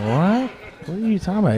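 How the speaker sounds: high, baffled what; mock confusion.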